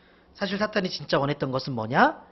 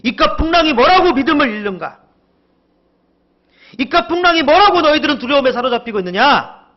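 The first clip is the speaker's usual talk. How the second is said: loud, high-pitched rebuke; stern scolding; 8 semitones higher, 9 dB louder than usual. Acted. Jesus scolding his frightened disciples, loud and stern. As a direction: scold at full force, indignant. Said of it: "이렇게 꾸짖는 것이에요".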